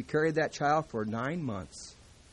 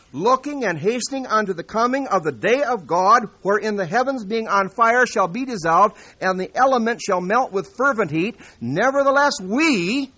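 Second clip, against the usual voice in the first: fiery pulpit proclamation, louder and higher; full-force preaching; 6 semitones higher, 9 dB louder than usual. Acted. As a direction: thunder out the verses to the congregation.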